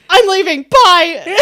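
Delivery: high mock yell, storming off; loud, abrupt retold sign-off. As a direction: imitate her shouting as she hangs up. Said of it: "raising our voices o-, over the phone".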